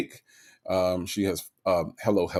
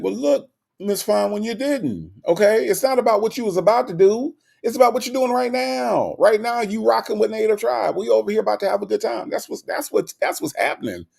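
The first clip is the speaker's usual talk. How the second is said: pitched way up, fired up and teasing; excited playful scolding; 11 semitones higher than usual. Real, not acted. Teasing Miss Fine One for wanting sleep, excited and high. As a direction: hype her up, no naps allowed.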